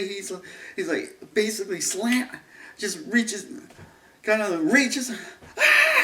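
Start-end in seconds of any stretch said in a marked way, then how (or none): 5.6-6.0 mock scream. Imitating the scream again.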